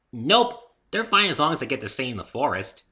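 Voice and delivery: high, loud, bouncy pony voice; high loud cheerful pony girl; bubbly Pinkie. A narrator's reading. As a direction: chirp it like an excitable pony.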